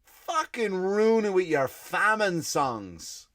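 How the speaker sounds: slow, drawn-out high whine of despair; weary exasperated drawl; exasperated groan. Retold or imitated. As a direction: moan it like the night's ruined. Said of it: "I was like".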